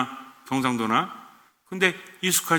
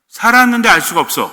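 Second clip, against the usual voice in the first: loud, pressing emphasis on every word; emphatic loud; 8 semitones higher, 11 dB louder than usual. Real, not acted. Preacher stressing a key point to the congregation, loud and emphatic. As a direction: drive the point home hard, almost shouting.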